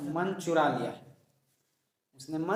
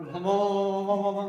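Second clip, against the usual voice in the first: mock background-music woofs, pitched up; imitated dog barking; 4 semitones higher than usual. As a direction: playfully voice a string of higher-pitched woofs. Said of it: "ये background music आएगा". (कुत्ते के भौंकने की नकल)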